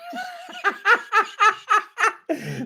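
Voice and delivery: high burst of laughter; laughing. Real, not acted. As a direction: laugh at your own outburst. Laughing after own shouted demo.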